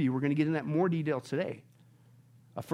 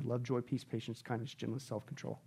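a much softer, lower, tender listing; 8 semitones lower, 13 dB quieter than usual. Real, not acted. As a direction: let each word settle gently. Listing fruits of the Spirit softly.

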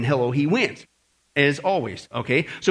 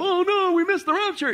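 mock panic; 9 semitones higher than usual. Mocking the panicked Thessalonians.

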